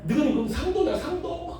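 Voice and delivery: mock retort. Jeolla preacher answering a Gyeongsang taunt, mock-indignant retort. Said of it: "그런데 그런지 말든지".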